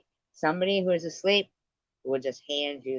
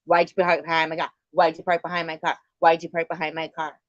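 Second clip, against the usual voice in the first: fast, louder nagging on a loop; relentless repeating; 2 semitones higher, 5 dB louder, faster than usual. Pretend. Nagging dad nonstop about parking behind her car, fast and loud. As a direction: rattle it off again and again, pushing harder. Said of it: "if he starts yelling, just keep going".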